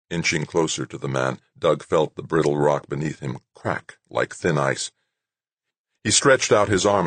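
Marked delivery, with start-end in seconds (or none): none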